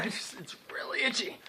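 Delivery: hushed whisper; whispered complaint about the mask. Real, not acted. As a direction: whisper the itch aside.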